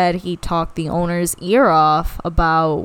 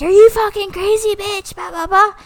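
much higher, louder mocking yell; retold outrage; 12 semitones higher, 3 dB louder than usual. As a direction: snap back at her, then trail off in blah blah. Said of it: "And I was like".

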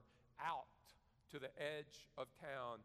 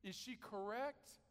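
higher, slow questioning plea; pleading prophet; 6 semitones higher, slower than usual. Retold or imitated. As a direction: ask God slowly, pitched up in anguish. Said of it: "He's saying".

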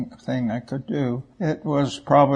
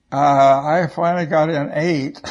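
laughing; 7 dB louder than usual. Real, not acted. Laughing while telling mission count.

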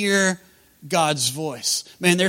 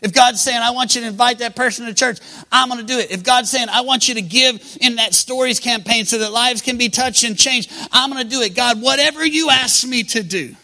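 raised, driving sermon voice; impassioned preaching; 5 semitones higher, 5 dB louder than usual. Acted. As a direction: build a rallying pledge, line after line. Preaches at full force.

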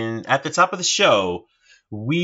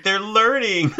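delighted; 10 semitones higher, 4 dB louder than usual. Retold or imitated. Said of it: "when you're like".

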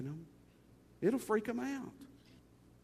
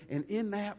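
normal talking voice

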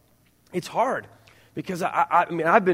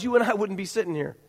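usual speaking voice

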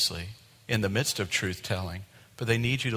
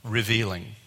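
ordinary delivery

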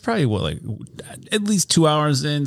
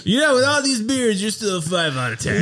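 mocking banter; 9 semitones higher than usual. Acted banter as characters.

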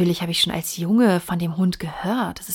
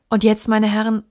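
louder, firm call to order; decisive; 3 semitones higher, 7 dB louder than usual. A narrator's reading. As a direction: take charge with resolve. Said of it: "mit Entschiedenheit".

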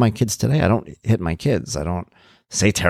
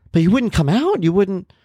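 much higher, disbelieving "you wouldn't?"; incredulous question; 11 semitones higher than usual. Retold or imitated. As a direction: voice the ex-wife's disbelief. Incredulous ex-wife questioning him.

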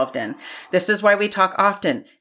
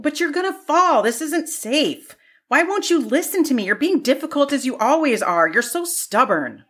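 higher, exasperated scolding; frustrated pleading; 8 semitones higher than usual. Acted. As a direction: lose patience with a stubborn parent. Acted frustrated quarrel with mother.

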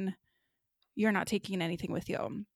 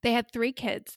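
usual speaking voice

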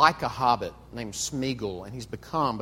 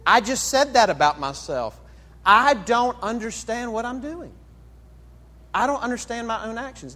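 higher, insistent Paul impression, hammering the point; exasperated Paul; 8 semitones higher, 4 dB louder than usual. Paul answering a doubter who missed his point, exasperated. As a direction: answer back as an exasperated Paul, pitch raised. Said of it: "Paul might say".

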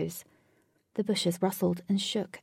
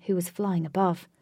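plain reading voice

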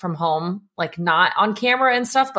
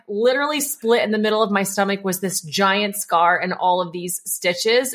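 usual speaking voice

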